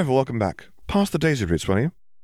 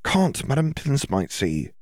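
put-on high, slow, hushed young woman; Hermione's voice; 7 semitones higher, slower than usual. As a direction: lift into Hermione's voice, unhurried.

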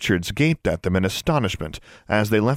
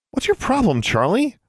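high-pitched telling-off; scolding; 14 semitones higher than usual. A narrator's reading. Girl to her agitated raven, scolding. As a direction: scold your pet, voice raised high. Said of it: "Alexandra scolded".

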